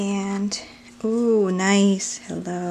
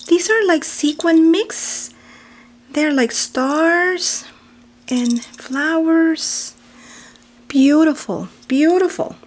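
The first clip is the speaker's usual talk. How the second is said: higher, drawn-out gushing delight; 6 semitones higher, 4 dB louder, slower than usual. Real, not acted. Admiring the sequins, she coos delightedly. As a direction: coo over each piece.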